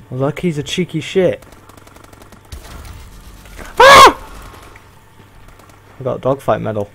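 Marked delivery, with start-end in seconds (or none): 3.8-4.2 sharp, very high yelp; startled scream. Real, not acted. Gamer caught off guard by an enemy, startled shriek. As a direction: shriek in shock. Sudden scare in game.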